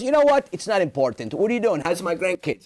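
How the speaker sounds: put-on Italian-accented father changing the subject; breezy Italian father deflecting; father dismissing it and asking about grandkids, Italian accent; Italian dad deflecting. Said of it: "with that accent".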